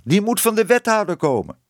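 hoge roep van de kat; naroepen. A narrator's reading. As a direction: iemand iets achterna roepen, hoog. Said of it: "riep de kat haar achterna". (hoog nageroepen)

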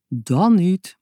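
usual speaking voice

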